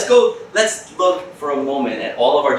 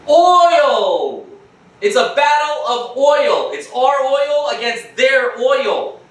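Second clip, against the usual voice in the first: high, slow, rallying shout; impassioned; 9 semitones higher, 4 dB louder, slower than usual. Real, not acted. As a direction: proclaim it like a battle cry. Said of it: "rallying climax".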